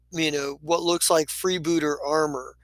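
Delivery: normal talking voice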